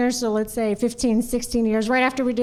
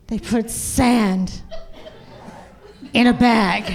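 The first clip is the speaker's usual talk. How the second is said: slow, dry Clint Eastwood-style dad; gruff dad; 2 semitones lower, much slower than usual. Retold or imitated. Dad answers mom slowly, a hint of Clint Eastwood. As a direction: drawl it out like a deadpan tough guy. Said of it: "kinda like Clint Eastwood".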